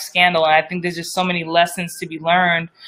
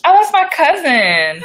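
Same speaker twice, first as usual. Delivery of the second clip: excited; 9 semitones higher, 4 dB louder, slower than usual. Real, not acted. Recognizes cousin in chat.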